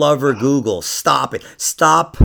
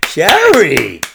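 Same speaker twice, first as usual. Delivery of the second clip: louder, higher shocked call of Sherry; scolding call; 5 semitones higher, 7 dB louder than usual. Real, not acted. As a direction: call her out in disbelief. Mock scolding outburst at friend.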